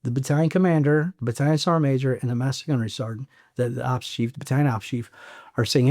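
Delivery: ordinary talk, nothing marked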